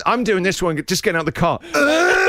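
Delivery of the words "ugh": loud, high strained grunt; effortful grunt. Pretend. Hauling himself out of the car, loud strained grunt. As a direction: heave yourself out with a groan.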